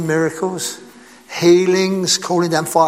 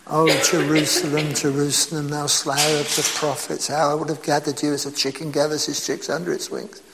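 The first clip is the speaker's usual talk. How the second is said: soft lament; 4 semitones lower, 7 dB quieter than usual. Acted. Quoting Jesus lamenting Jerusalem.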